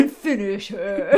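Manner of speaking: slow, drawn-out announcer call, laughing through it; mock arcade announcer voice with a laugh; Mortal Kombat announcer. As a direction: intone it like a fighting-game announcer ordering the finishing move. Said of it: "like, in Mortal Kombat".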